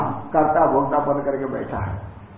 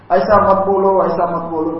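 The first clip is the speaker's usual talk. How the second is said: loud, higher-pitched objection; raised voice, mock-pained plea; pained protest; 4 semitones higher, 7 dB louder than usual. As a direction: imitate a king protesting in distress. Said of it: "उनको भीतर पीड़ा हो रही है".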